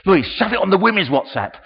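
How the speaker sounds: acted-out eager shout; urgent call-out. Calling out to get more prayers.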